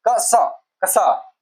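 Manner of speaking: "कसा" slowly drawn out, k and sa blended into one sound; careful pronunciation. Shown as a demonstration. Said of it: "इसका ठीक से उच्चारण क्या होगा?". ("कसा" धीरे-धीरे, क और सा को जोड़कर बोलें)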